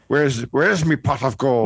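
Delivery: slow stage-Irish line; hammy leprechaun brogue; Irish accent. Pretend. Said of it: "you do a pretty good Irish accent".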